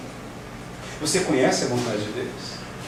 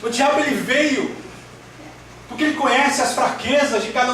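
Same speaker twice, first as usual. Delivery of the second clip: high, emphatic preacher's quote; forceful warning to a disciple; Jesus; 8 semitones higher than usual. Acted. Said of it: "Jesus estava dizendo". (voz de Jesus em tom alto e enfático)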